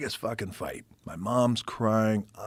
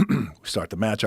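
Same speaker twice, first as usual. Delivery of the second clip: normal talking voice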